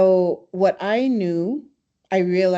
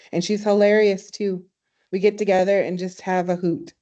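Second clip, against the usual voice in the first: ordinary delivery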